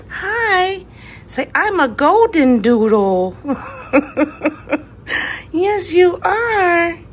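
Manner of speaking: high, loud cooing puppy talk. Real, not acted. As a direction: coo at him, high and drawn out.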